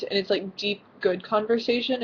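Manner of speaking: ordinary talk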